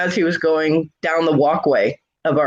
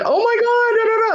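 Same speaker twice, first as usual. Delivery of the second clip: much higher, frantic oh my God; panicked nurses. Retold or imitated. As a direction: squeal it like alarmed nurses.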